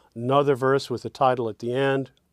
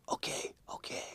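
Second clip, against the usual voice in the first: breathy whisper, far softer; whispered line; 16 dB quieter than usual. Shown as a demonstration. Songwriter whispering what the second line says. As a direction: hush the words right down. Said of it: "the second line is saying".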